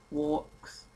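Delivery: ordinary talk, nothing marked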